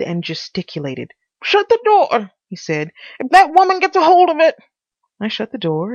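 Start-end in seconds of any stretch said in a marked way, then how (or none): 1.4-2.3 very high, loud urgent order; anxious, angry Pycraft. A narrator's reading. Pycraft ordering his visitor, anxious and angry. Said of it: "His face was anxious and angry. He panted". 3.2-4.6 high, loud, hurried character voice; anxious Pycraft. A narrator's reading. Pycraft fearing the housekeeper, anxious and fast. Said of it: "His face was anxious and angry. He panted".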